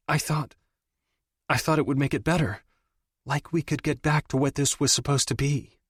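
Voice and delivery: put-on a stumbling young woman trying to explain; hesitant hurt lover. A narrator's reading. Audrey voiced by narrator.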